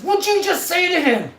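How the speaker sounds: high, loud re-enacted phone challenge; angry confrontation. Buddy grabs phone from scared kid, furious demand. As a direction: confront the caller, outraged.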